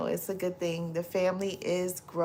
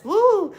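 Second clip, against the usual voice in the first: mock woo; 7 dB louder than usual. Reading Annie's cheer.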